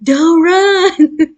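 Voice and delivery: loud, high mock scream; screaming doctors. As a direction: imitate doctors yelling in alarm. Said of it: "a hundred doctors screaming at you".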